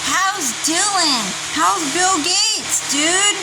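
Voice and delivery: high, slow, prying neighbor; nosy neighbor voice. Retold or imitated. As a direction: drag out each nosy question. Mocking nosy neighbors' questions.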